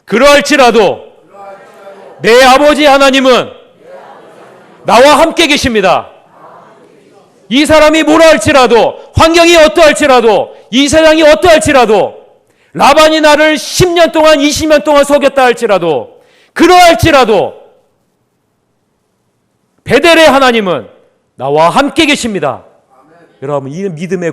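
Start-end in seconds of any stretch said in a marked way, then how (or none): 0.1-6.1 proclaimed slowly for the church to repeat; slow call and response. Shown as a demonstration. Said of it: "여러분, 따라해 보십시오". 7.5-22.7 higher, building preacher's cry, each 할지라도 pressed; rising sermon climax; preacher rallying the congregation in rising waves; preaching at full force. Acted.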